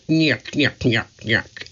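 imitating Curly's nyuk nyuk chuckle; playful nasal nyuk nyuk laugh; funny nyuk sounds. Curly making his silly sounds, mock nyuk laugh. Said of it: "He made funny sounds".

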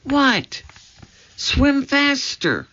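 high-pitched, urgent order; high, stretched-out urgency; urgent alarm. A narrator's reading. Urgent exchange while fleeing crocodile.

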